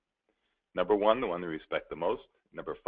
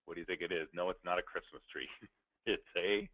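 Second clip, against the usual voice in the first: ordinary delivery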